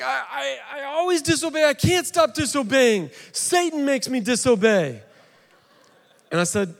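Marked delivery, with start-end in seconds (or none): 0.0-5.0 put-on high, sing-song child's voice, much higher; little boy voice. Son tells dad Satan makes him disobey, high child voice. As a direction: whine it like a four-year-old blaming the devil.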